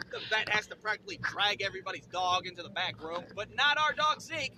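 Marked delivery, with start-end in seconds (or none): none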